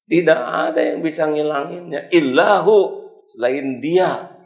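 high and loud, slowing at the end; preacher raising his voice on God's power; preaching at full force. Real, not acted. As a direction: drive the point home. Preaching climax.

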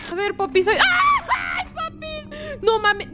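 panicked yelling while swinging. Real, not acted. Swinging across a chasm.

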